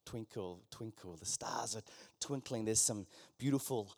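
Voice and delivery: whispered, soft and low; soft whispered scene-setting; hushed storytelling. Acted. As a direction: draw them into the starry night in a hush. Guided imagination, eyes closed.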